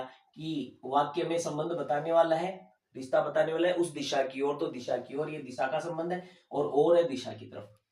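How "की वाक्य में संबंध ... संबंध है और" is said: ordinary talk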